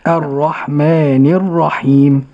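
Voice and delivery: الرحمن الرحيم recited slowly and carefully; tajweed repetition. Shown as a demonstration. Host echoing the sheikh's recitation slowly. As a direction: repeat after the sheikh slowly. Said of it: "host repeats after sheikh".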